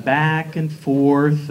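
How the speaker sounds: slow, bored "back and forth"; dragged-out weary sigh; drawn out. Pretend. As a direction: stretch it out like endless laps. Dragging out the endless laps.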